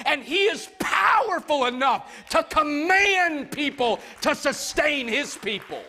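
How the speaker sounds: high, loud, driving preacher's build; preaching at full force. Acted. Pastor preaching hard to the congregation, loud and high. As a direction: lift the congregation with rising fervor.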